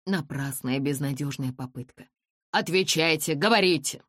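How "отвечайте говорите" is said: loud, commanding order. A narrator's reading. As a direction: demand an answer. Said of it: "приказал он громко и властно".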